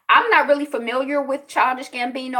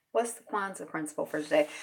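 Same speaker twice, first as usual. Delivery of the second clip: ordinary delivery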